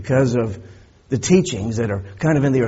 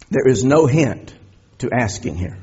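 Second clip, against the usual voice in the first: normal talking voice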